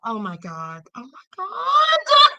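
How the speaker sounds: recalled distress, swelling to a cry; retold anguish. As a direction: relive the horror as it breaks. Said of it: "I thought".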